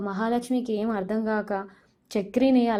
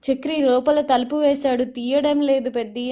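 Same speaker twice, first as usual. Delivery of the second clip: retold yell; 4 semitones higher, 6 dB louder than usual. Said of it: "అని గట్టి గట్టిగా అరుస్తుంటుంది".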